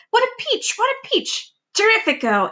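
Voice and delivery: very high, gushing delight; Aunt Sponge crying out over the peach. Acted. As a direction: squeal with greedy joy. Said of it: "Aunt Sponge cried out".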